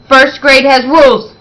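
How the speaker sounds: firm warning to giggling class; stern reminder. Acted. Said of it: "Miss Trisk reminded them".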